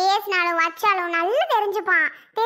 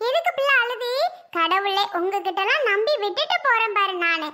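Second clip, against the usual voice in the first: normal talking voice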